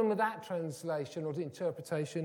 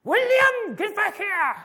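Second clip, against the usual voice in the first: calling out sharply; 14 dB louder, slower than usual. Retold or imitated. Parent calling child indoors.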